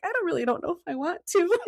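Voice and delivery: high, quick laughing reluctance; laughing dread. Retold or imitated. Telling husband she won't look, high and laughing. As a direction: giggle it out nervously. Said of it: "I'm like".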